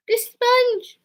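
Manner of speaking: high, loud cry of relief at finding it; excited find. Real, not acted. Finding her lost makeup sponge, a high relieved cry. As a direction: squeal as she spots the sponge.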